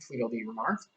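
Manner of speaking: ordinary delivery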